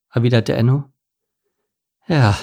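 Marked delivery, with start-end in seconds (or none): 2.1-2.4 resigned fatherly sigh; weary, sighed agreement. A narrator's reading. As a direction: let the word out as a sigh. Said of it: "seufzte Papa".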